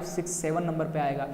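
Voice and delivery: ordinary talk, nothing marked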